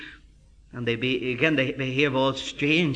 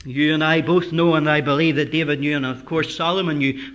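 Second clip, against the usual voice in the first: ordinary talk, nothing marked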